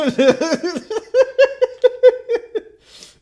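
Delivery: loud burst of high laughter. Real, not acted. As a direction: crack up loudly.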